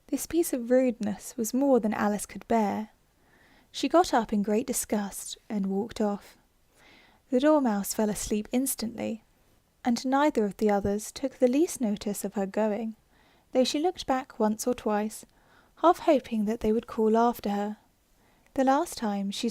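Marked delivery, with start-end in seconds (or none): none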